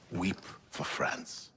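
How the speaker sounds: slow, breathy whisper of despair; a weary, whispered sigh for the nation; hushed lament. Acted. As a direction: mourn it under your breath, slowly. Mentor laments France's state.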